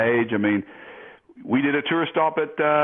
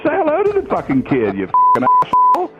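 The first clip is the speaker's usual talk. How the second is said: furious rant; 5 semitones higher, 4 dB louder than usual. Retold or imitated. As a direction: chew him out in a high yelling voice. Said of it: "I said".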